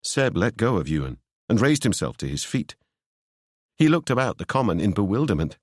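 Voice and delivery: ordinary delivery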